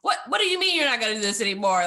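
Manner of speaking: mock frustrated nephrologist, fast and loud; frustrated doctor. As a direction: fire it back at her, incredulous and quick. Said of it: "frustrated with me".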